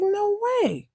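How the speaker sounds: very high, thrilled exclamation; amazed disbelief. Acted. Mouse hearing cat is godfather again, high and amazed. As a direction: react to astonishing news in a high voice.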